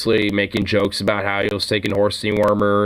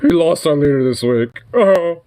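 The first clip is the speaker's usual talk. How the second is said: higher, louder sarcastic echo of the line; mocking repeat; 4 semitones higher, 3 dB louder than usual. Mocking the anchor's grief line, a sarcastic echo. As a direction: parrot the anchor's line with scorn. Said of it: "Oh my god".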